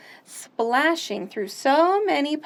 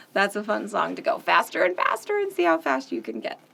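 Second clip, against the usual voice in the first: ordinary delivery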